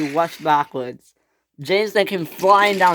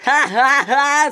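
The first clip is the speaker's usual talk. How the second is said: high, loud mocking "ha ha ha"; shrieked, gloating cackle; screamed laugh; 10 semitones higher, 6 dB louder, slower than usual. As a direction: jeer with a loud fake laugh. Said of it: "screamed Billy".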